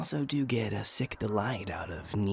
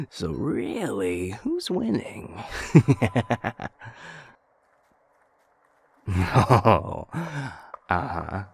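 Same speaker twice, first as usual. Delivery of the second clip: slow, drawn-out tease rising high; playful mock-innocent who's winning; teasing; 4 semitones higher, 8 dB louder, slower than usual. Real, not acted. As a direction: needle them with a knowing, slow question. Teasing friend about game wins.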